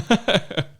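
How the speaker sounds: short high laugh. Real, not acted. One friend amused by a silly show, laughing. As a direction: burst out in a high, loud laugh.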